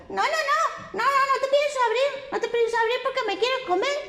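very high, fast frightened piggy voice; high squeaky pig refusing the wolf; squeaky little pig. A narrator's reading. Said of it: "el cerdito le dijo".

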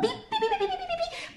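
very high rapid pi pi chirps; quick high peeping; bird chirping. Acted. Bird chirps between words.

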